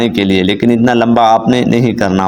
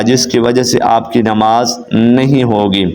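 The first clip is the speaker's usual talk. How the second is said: ordinary delivery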